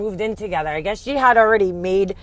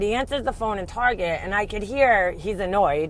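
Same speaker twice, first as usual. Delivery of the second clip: ordinary talk, nothing marked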